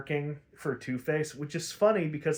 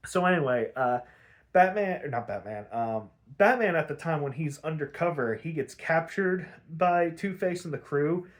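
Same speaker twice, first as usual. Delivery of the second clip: ordinary talk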